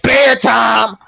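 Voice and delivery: put-on mother ordering the child to bed; mama's bedtime order. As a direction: shut the kid down like mama. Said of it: "She be like".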